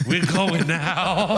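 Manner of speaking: high, very drawn-out imitation; insistent girl. Girl leaves after hitting the man, drawn-out high imitation. Retells girl's order after the punch.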